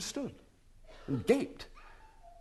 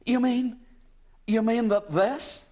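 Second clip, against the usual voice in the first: hopeful; 9 semitones higher, 4 dB louder, slower than usual. A narrator's reading. Hopeful lady.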